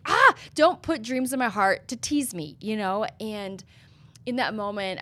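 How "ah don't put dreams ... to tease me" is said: higher and louder, exasperated complaint; frustrated plea. Retold or imitated. Retold frustrated cry to God about the dream, exasperated. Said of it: "I was so frustrated".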